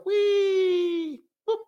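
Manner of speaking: high squeaky wee then boop; playful sound effect. Acted. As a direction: mimic the moon rolling away, cartoon style. Moon rolling around.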